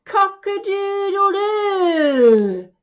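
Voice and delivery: long, loud, high crow; crowing rooster. Acted. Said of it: "crowed the rooster".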